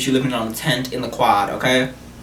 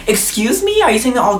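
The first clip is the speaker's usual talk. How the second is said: high, shocked excuse me; indignant gasp of a question; outraged disbelief; 8 semitones higher than usual. Real, not acted. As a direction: recoil in offended shock, much higher. Outraged at roommate's insult.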